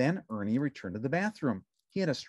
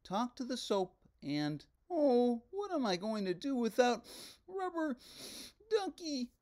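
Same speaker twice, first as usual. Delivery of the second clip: softer, high puppet voice, a little forlorn; Ernie voice, a touch sad; 11 semitones higher, 4 dB quieter than usual. A narrator's reading. Ernie sadly explaining his lost toy to the detective.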